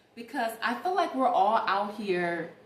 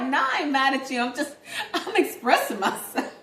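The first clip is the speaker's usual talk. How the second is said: louder and higher, laughing through the words; laughing reply; 7 semitones higher, 7 dB louder than usual. Retold or imitated. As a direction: laugh while insisting you're not mad. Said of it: "I'm like".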